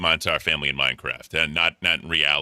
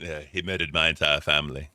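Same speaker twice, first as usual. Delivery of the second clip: mock British accent, lower-key delivery; 3 semitones lower than usual. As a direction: imitate him with a British accent. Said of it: "saying that in a British accent".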